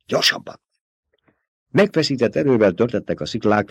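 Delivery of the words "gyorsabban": breathless, voiceless hurry-up order; urgent whispered command. A narrator's reading. Paul urging his mother up the dune, whispered. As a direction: push her on in a rushed whisper. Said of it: "parancsolta Paul".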